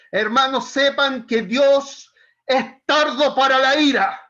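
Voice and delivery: shouted scolding, high and very loud; grito de regaño. Shown as a demonstration. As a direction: thunder it at the congregation, drawn out and angry. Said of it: "estoy gritando, estoy retando". (grito airado, retando a los hermanos)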